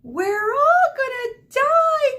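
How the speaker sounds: very high, loud, slow wail; long high terrified wail. A narrator's reading. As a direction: wail it out in terror, stretched. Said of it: "Cassandra wailed".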